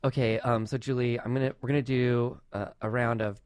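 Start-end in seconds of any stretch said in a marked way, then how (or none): none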